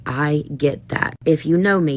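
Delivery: usual speaking voice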